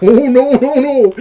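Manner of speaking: high, loud alarmed protest; mock alarm. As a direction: cry out in hammed-up alarm. Reacting to a poisonous spider bite.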